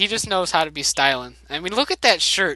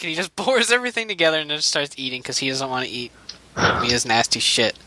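normal talking voice

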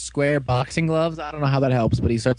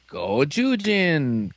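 GOJUJIN stretched out very slowly; mock toy callout; much slower than usual. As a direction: imitate the toy's announcement with drawn-out syllables. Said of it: "'cause I don't feel like pushing the button".

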